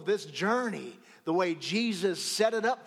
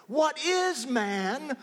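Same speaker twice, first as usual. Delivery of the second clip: high, very slow, "is" held long; stress on IS; 8 semitones higher, much slower than usual. Shown as a demonstration. Preacher marvelling that the Creator listens, long high stress on "is". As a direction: raise and stretch "is" in "What is man". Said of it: "emphasis on a different syllable".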